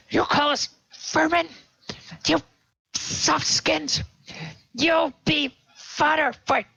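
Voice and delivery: very high-pitched, enraged kobold; kobold quivering with rage. Acted. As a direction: rant as a furious little creature, way up high. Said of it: "quiver with rage and says".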